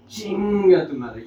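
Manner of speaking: ordinary talk, nothing marked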